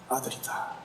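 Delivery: breathy hush of a father's recognition; a father seeing his son from far off, barely breathed. Acted. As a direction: let it slip out under the breath in disbelief.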